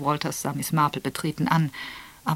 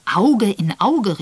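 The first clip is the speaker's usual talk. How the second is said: slow, loud, higher exclamation of „Auge in Auge“; exclaiming; 6 semitones higher, 11 dB louder, much slower than usual. Acted. Raphael seizes on Esther's phrase, exclaiming slowly and loudly. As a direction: stretch „Auge in Auge“ out loud, as if struck by it. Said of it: "rief Mister Raphael aus".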